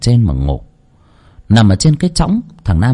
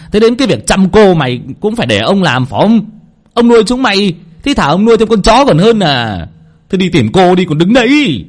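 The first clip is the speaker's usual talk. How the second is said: scolding master; 5 semitones higher than usual. Acted. Old master scolding the maid to go find his daughter, furious. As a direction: tear into the servant.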